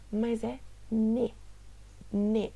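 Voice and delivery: ne with a closed E, not né; Italian ne. Shown as a demonstration. Teacher models Italian ne with closed E. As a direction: say ne with a closed, short e sound. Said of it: "não é né".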